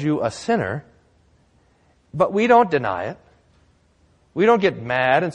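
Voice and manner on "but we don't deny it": usual speaking voice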